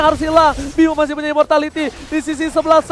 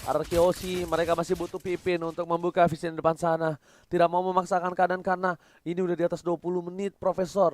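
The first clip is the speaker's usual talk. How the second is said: quiet commentary; 9 semitones lower, 11 dB quieter than usual. Real, not acted. Quiet calm analysis stretch.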